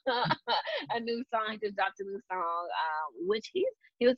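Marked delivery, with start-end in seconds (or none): 0.0-1.5 laughing. Real, not acted. Podcaster correcting a slip of the tongue, laughing. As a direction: giggle through the correction.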